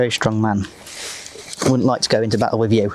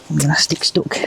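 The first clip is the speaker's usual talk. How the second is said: ordinary talk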